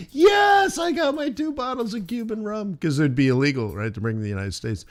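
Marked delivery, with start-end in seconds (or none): 0.1-2.8 high gleeful shout; triumphant shout. Retold or imitated. Triumphant shout over smuggled rum.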